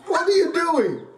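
can't believe what he's seeing; incredulous. Real, not acted. Reacting to bizarre clip.

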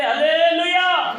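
long, drawn-out hallelujah, high and loud. Real, not acted. Preacher rousing the congregation, loud drawn-out hallelujah. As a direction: call it out slowly at full voice. Rousing shout, later contrasted soft.

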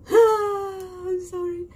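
apologising through laughter; laughing apology. Real, not acted. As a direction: giggle through the sorry.

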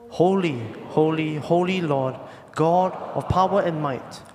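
ordinary delivery

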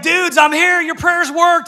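high, loud, thrilled burst of news; excited shout. Retold or imitated. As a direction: yell it like you just got sprung from jail. Peter bursting in at prayer meeting.

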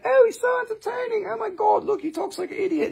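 tiny, very high falsetto; mock admiring fan. Viewer marvelling at the funny host, mock falsetto. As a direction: imitate a squealing, delighted viewer. Voices the admiring show audience.

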